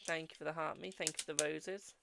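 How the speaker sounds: ordinary talk, nothing marked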